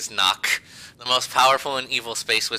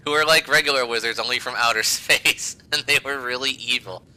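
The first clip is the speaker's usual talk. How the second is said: usual speaking voice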